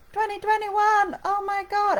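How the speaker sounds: high, loud burst of excitement; excited exclamation. Real, not acted. Vlogger thrilled about planning next year, exclaiming excitedly. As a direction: gasp it out with delight.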